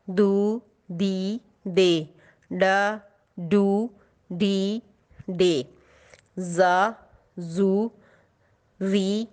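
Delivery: put-on slow sing-song drill of syllables for children; teacher reading a syllable chart aloud. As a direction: read each syllable clearly, like a teacher.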